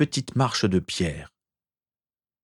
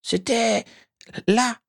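very high, squeaky, halting elf voice; high-pitched hesitant mumbling; stammering elf; slower than usual. A narrator's reading. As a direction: falter between the words in a tiny creature voice. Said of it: "bredouilla l'elfe".

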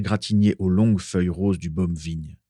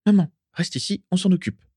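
high, hurried-feeling alarm; urgent; 11 semitones higher than usual. A narrator's reading. Daisy telling her mother to stay put, urgent. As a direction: take charge in a higher, anxious voice. Urgent order as Carla approaches.

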